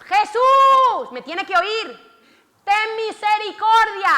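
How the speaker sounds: shouted plea. Acted. Lepers shouting to Jesus from a distance, desperate loud cry. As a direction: call out to Jesus from far away. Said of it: "estoy teatralizando".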